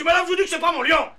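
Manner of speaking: high, fast, loud protest; heated denial. Retold or imitated. Owner denies it to the neighbour lady, fast and high. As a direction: deny it quickly, getting worked up. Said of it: "Je dis".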